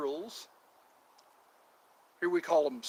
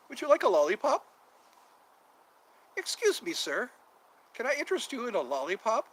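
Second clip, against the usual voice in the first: high, bright salesman offering lollipops; mock vendor pitch; 6 semitones higher, faster than usual. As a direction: play a chirpy aisle seller. Hawking lollipops in the aisles.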